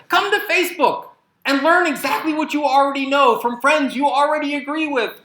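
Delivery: loud, high, sarcastic ad voice; mock sales pitch. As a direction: sell it like a cheesy ad, dripping with irony. Mocking a Facebook sales pitch.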